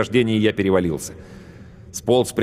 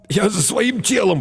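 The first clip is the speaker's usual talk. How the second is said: high, slow choked gurgle; gurgling strangled voice; 12 semitones higher, slower than usual. A narrator's reading. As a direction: force it out through a tight throat. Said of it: "пробулькал я задавленно".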